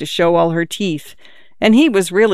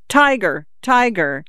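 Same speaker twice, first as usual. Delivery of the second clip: put-on child's shout; 3 semitones higher than usual. A narrator's reading.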